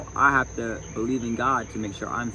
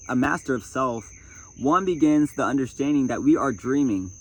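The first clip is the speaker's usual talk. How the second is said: louder, emphatic read-aloud voice; projected reading from a self-help book; 4 semitones higher, 5 dB louder than usual. Acted. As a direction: read it out firmly to an audience.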